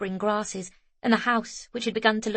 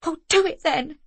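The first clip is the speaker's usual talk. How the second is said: tense snap; 8 semitones higher, 4 dB louder than usual. A narrator's reading. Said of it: "a tightly coiled wire".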